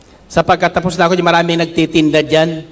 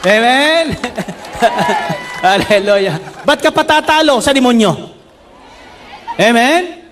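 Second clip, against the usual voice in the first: fiery preaching; 5 semitones higher than usual. Acted. Pastor exhorting the congregation, fiery preaching. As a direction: preach it out, rising and pressing.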